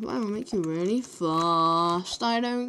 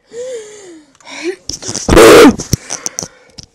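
big loud shocked gasp; 11 semitones higher, 19 dB louder than usual. Real, not acted. Sudden surprise.

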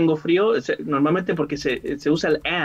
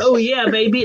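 higher-pitched mock gringo English; gringo accent; 4 semitones higher than usual. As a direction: put on a playful gringo accent. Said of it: "hablar así como gringo". (acento gringo en broma, más agudo)

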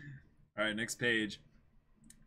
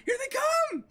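alarmed cry. Acted. Nami warns the others as pirates attack, alarmed shout. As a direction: call out the pirates' charge in alarm.

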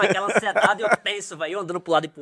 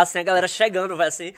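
normal talking voice

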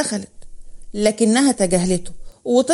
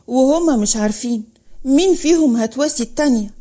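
ordinary delivery, nothing marked

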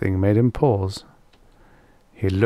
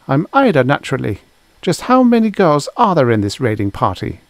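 put-on higher, louder girl's voice; raised-pitch character voice for a bold girl; brisk girl; 6 semitones higher, 5 dB louder than usual. A narrator's reading. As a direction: answer as a confident, demanding girl. Girl confronts Roddy, voiced by narrator.

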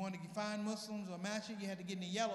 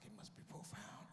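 unvoiced awed whisper; 12 dB quieter than usual. Comic whispering, mock impressed by guard.

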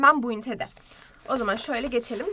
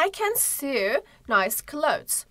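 slow example; 2 semitones higher, much slower than usual. Shown as a demonstration. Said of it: "example sentence read slowly".

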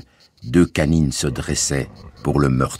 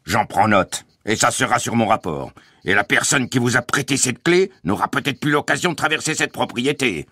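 raised, gloating menace; official threat; 7 semitones higher than usual. A narrator's reading. Guard will report whoever lent the key, menacing. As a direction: build higher as the threat grows.

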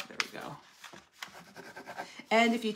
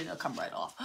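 ordinary talk, nothing marked

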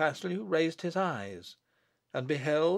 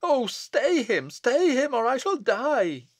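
high, loud, frantic pleading; desperate cry; 9 semitones higher, 5 dB louder, slower than usual. Acted. Bess begs her husband to stop the abbot, desperate cry. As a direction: cry out for help, pitched right up. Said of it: "cried Bess".

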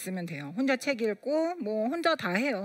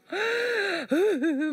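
sobbing gasp; 8 semitones higher than usual. Retold or imitated. Retelling sobbing to the pastor.

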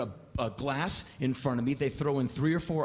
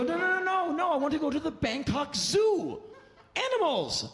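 much higher, laughing no, no, no; laughing protest; 14 semitones higher than usual. Retold or imitated. Comic tells the driver he means the real zoo, high and laughing. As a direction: correct him in giddy disbelief.

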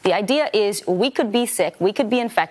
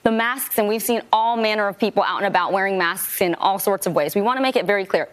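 normal talking voice

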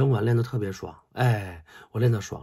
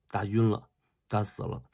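ordinary talk, nothing marked

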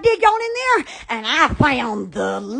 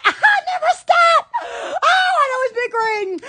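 laughing joke; 9 semitones higher than usual. Real, not acted. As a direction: crack yourself up on it.

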